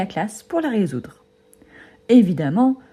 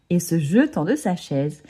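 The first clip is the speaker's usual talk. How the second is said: ordinary delivery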